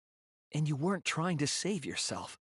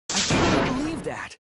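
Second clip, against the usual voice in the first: firm conviction; 10 semitones higher, 8 dB louder than usual. Acted. Shuichi insists on Kaede's innocence.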